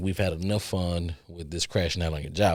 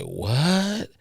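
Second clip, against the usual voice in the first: 1 shocked what; 8 semitones higher than usual. Retold or imitated. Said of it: "I was like".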